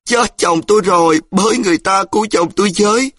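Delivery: high, loud, fast cry for help; wife screaming for help as husband is hit; panicked wail. A narrator's reading. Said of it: "ôm mặt rú lên".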